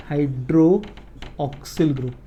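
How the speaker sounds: Hydroxyl drawn out part by part; hydroxyl stretched out at half speed; slow dictation. Real, not acted. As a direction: say hydroxyl slowly, as if writing along. Repeats the term while writing.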